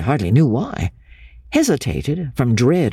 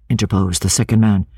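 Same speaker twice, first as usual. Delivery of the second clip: ordinary delivery, nothing marked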